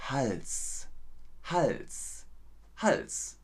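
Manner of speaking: "Hals" enunciated slowly with each sound distinct; careful teacher's "Hals", one word at a time; very clearly pronounced. Shown as a demonstration. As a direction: say "Hals" drawn out, with a crisp final s. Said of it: "ich sag's nochmal ganz deutlich".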